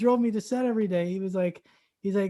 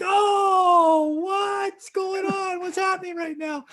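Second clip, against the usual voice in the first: high, slow, stunned shouting; overwhelmed disbelief, shouted high; bewildered shout; 12 semitones higher, much slower than usual. Retold or imitated. Bewildered at free round.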